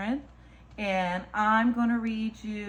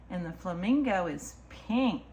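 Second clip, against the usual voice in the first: ordinary delivery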